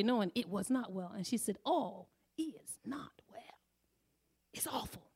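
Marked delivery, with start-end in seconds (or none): 1.6-5.1 hushed whisper. Retold or imitated. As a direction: whisper it like a secret grief. Coworker confides bad news.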